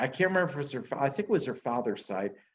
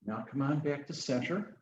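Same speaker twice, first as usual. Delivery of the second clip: normal talking voice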